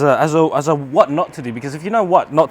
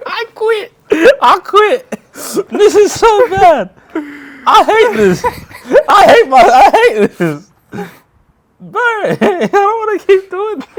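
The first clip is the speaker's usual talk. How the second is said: high, slow, laughing whine; half-laugh, half-cry in frustration; laughing despair; 6 dB louder, slower than usual. Real, not acted. Everything going wrong, laughing despair.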